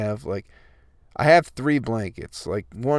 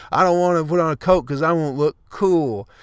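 higher, louder snotty poser voice; mock whiny poser; 5 semitones higher, 4 dB louder than usual. Vlogger mocking vain coatless people, snotty mock voice. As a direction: sneer it like a vain kid who won't wear a coat.

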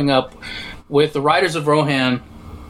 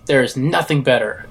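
usual speaking voice

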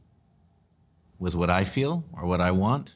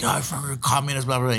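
mock angry hater; 5 semitones higher, faster than usual. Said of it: "And they're like".

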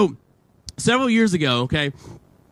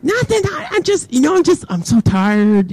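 evasive; 7 semitones higher than usual. Retold or imitated. Dodging girlfriend's question.

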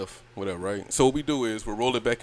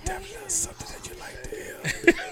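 whispering; 4 dB quieter, faster than usual. Real, not acted. Whispering back into her ear.

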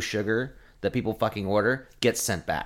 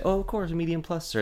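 lightly voiced sugary server, a touch higher; mock-servile customer service voice; fake polite server; 7 semitones higher than usual. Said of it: "You say".

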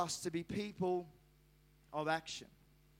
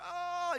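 high mock "oh" of pain; imitated pained cry; 10 semitones higher than usual. Preacher imitates his son with a splinter, pained cry. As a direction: cry out high like a hurt child.

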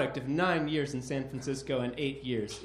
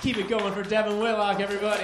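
loud, high host call for applause; hyping the crowd; 5 semitones higher, 6 dB louder, faster than usual. Real, not acted. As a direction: get the room clapping.

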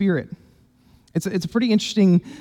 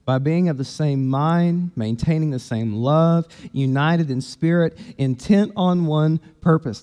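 scripture reading; 6 semitones lower, 3 dB quieter than usual. Acted. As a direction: read the verses quietly, letting each phrase land. Reads the scripture passage aloud.

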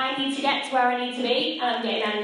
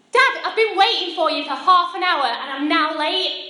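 louder and higher, accusing; annoyed complaint; 5 semitones higher, 6 dB louder than usual. Retold or imitated. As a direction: scold dad for making you wait. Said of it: "It kinda looks a little bit like this".

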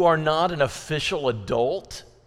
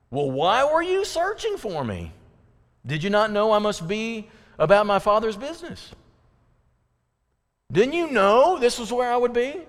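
high, louder, mildly exasperated retort; Jesus's reply; 8 semitones higher, 3 dB louder than usual. Retold or imitated. Twelve-year-old Jesus answering his anxious parents, high and pert. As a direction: answer like a bright kid surprised they didn't know. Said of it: "He says".

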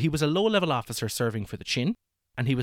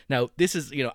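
normal talking voice

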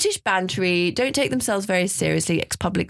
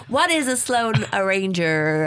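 slow, drawn-out American-ish question; American accent; slower than usual. Retold or imitated. Guest imitating confused foreign clients in an American accent. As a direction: put on a vague American-Australian twang. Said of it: "that's a really good accent".

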